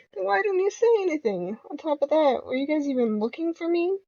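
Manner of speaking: high, whiny protest; indignant complaint. Acted. Player whines at the two men, high indignant protest. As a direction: sound put out and left behind.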